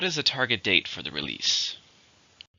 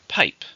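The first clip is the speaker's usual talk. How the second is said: Pipe, said higher and louder as one crisp syllable; clear model word; 4 semitones higher, 5 dB louder than usual. Shown as a demonstration. Teacher modelling one word for learners, crisp and loud. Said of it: "one syllable".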